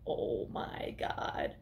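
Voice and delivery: slow, drawn-out stage whisper; horrified whisper. Retold or imitated. Girl finds her brother's head, horrified whisper. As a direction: whisper it in mock horror, very slowly.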